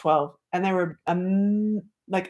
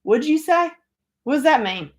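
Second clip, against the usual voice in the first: puzzled disbelief; 7 semitones higher than usual. Retold or imitated. Retelling her puzzled reply.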